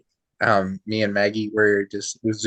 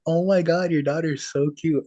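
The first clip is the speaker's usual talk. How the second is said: high-pitched mock gush of a stranger; imitated gushing; 7 semitones higher than usual. Store lady admiring a stranger's little girl, imitated gushing. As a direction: lightly coo like an admiring stranger.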